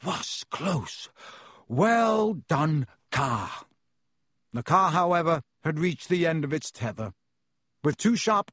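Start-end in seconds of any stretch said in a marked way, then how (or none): 0.0-3.6 broken, puffed-out words; panting. A narrator's reading. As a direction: pant between the words. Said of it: "panted Ron".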